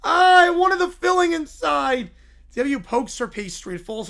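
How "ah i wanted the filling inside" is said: loud, higher wailing complaint; mock crying. Caillou loses his filling, loud whiny wail. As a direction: whine it like a hammed-up upset kid. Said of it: "He cries".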